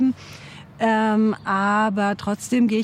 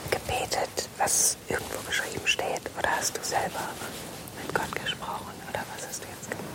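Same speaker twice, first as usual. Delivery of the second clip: very soft whispered questions; real whisper; 11 dB quieter than usual. Real, not acted. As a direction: ask in a barely audible whisper. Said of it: "müssen wir auch leise sein". (ganz leise flüsternd fragen)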